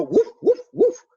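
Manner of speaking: very high, loud yapping guau guau; dog bark imitation. Shown as a demonstration. Teacher barking in Spanish for the class, very high yaps. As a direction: yap like a small dog.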